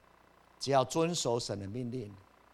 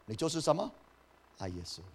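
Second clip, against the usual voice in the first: ordinary delivery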